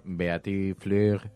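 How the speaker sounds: slow, drawn-out French pronunciation; French accent. Retold or imitated. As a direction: say the name like a French speaker. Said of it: "he hecho yo una interpretación libre del acento francés".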